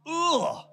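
high-pitched jokey "ugh" of disgust; mock disgust. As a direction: groan in mock revulsion. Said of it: "I'm just messing with it".

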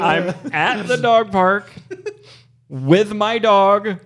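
slow, spelled-out recap; slow deliberate retelling. Shown as a demonstration. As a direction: lay it out slowly, word by word. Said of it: "you remind me so much of Squidward".

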